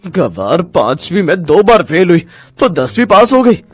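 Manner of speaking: scornful, furious husband; mock her and throw her out; angry contempt. Enraged husband insults and rejects wife. (गुस्से और तिरस्कार से)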